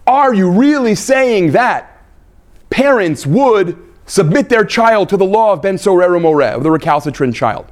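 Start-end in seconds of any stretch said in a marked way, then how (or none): none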